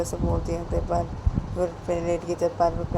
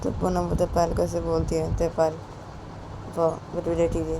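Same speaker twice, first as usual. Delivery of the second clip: ordinary delivery, nothing marked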